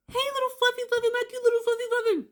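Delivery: high cooing baby talk, imitating him; sweet baby talk to the dog. Boyfriend turning from her call to coo at his dog. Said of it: "He always talked sweet to Fluffy".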